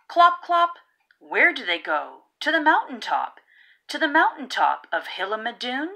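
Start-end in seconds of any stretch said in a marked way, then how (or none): 0.1-0.7 clop clop. Acted. Hooves on the road.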